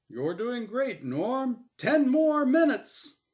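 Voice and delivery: loud, high, cheery host voice; game show host. Acted. Game show host Azrael cheers Norm on, loud and bright. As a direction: boom it out like a TV emcee.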